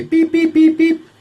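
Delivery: high, loud electronic beeps; sharp high beep, repeated; imitated radio beeps. Man hears police radio coming, imitated high beeps.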